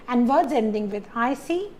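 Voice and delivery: normal talking voice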